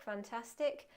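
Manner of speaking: normal talking voice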